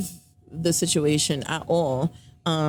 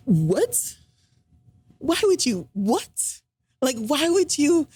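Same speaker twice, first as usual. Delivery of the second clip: incredulous what; 8 semitones higher, slower than usual. Retold or imitated. Reacting to period remark.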